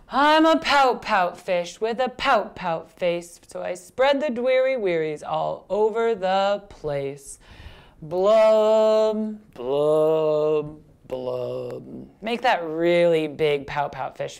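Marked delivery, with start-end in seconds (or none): 0.1-7.4 put-on slow, heavily accented rhythmic refrain; gloomy pout-pout fish. Fish tells of his pouty face, slow gloomy chant. 8.0-12.1 long blubs stepping down in pitch; gloomy fish blubs. Acted. Teacher leads children in the blub part, descending blubs.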